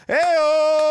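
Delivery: loud, high whoop of a punchline; triumphant heyo. Real, not acted. Cohost cheering his own dick joke with a loud whoop. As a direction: crow it like you nailed the joke.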